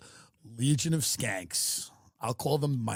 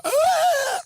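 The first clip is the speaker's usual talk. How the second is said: a high, loud, off-key screech; bad singing wail; 9 dB louder than usual. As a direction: belt a terrible high note like an untalented kid. Imitating bad theater kid singing.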